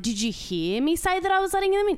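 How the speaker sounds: high, loud exasperated question; indignant protest. Retold or imitated. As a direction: push back in disbelief. Narrator retells her protest.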